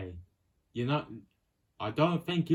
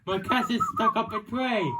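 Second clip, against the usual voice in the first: high-pitched urgent calling out; urgent plea; 13 semitones higher, 3 dB louder than usual. Acted. Cat owner begging a departing pirate to stay, high urgent plea. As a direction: call after someone who is leaving.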